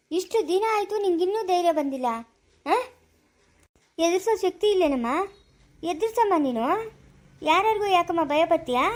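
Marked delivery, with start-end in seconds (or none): none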